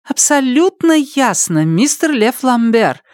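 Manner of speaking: high, far voices exclaiming together; chorused exclamation. Acted. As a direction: cultists answering in unison. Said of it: "хором восклицают Василевы".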